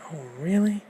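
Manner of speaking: high, loud oh really; startled. Acted. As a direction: jolt up in surprise.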